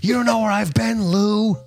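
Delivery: high, strained movie-quote voice; Fight Club quote. Acted. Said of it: "Fucking Fight Club".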